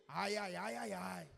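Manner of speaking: a parent's reproachful tut-tut; mild mock reproach to a noisy child; scolding ai. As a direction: chide a messy child with a lightly voiced ai ai ai. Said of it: "o ai de repreensão".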